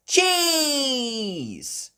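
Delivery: high, loud, drawn-out shout; calling out. Acted. Peppa delivering shopping to Grampy Rabbit, high call. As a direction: sing out the delivery.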